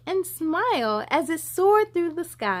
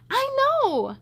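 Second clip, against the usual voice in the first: eager agreement; 12 semitones higher than usual. Acted. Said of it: "Joshua agreed".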